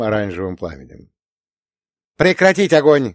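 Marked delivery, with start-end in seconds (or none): 2.2-3.1 sharp loud command; shouted order. A narrator's reading. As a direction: bark the cease-fire order. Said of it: "крикнул Рута". (громкий приказ)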